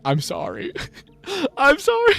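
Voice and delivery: high, laughing "I'm sorry"; laughing apology. Retold or imitated. Mom apologizing to son after finding Chromecast, laughing. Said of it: "She's laughing".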